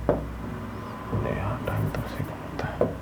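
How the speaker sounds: under-the-breath whisper to himself; real whispered aside. Real, not acted. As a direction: mutter it away from the mic.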